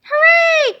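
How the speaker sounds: high, loud cheer; cheering kids. Acted. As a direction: yell hooray like excited kids. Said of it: "cried the children".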